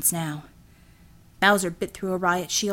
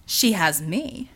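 Devil's steady voice; much slower than usual. Acted. Devil, slow, low.

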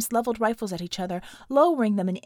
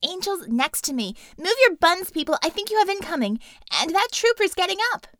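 higher, louder urgent warning; urgent character voice; 8 semitones higher, 5 dB louder than usual. Acted. Warning the team over comm, higher and urgent. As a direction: call out the danger fast and high.

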